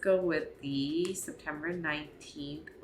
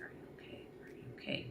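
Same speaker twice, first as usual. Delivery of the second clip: hushed, breathy check-in repeated twice; retold whisper; 15 dB quieter, faster than usual. Groom quietly checking on the crying bride, whispering. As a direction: whisper it so nobody around hears.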